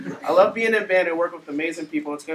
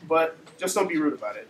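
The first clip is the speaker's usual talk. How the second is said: usual speaking voice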